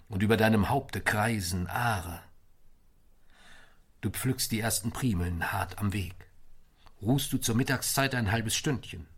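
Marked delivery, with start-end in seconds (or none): none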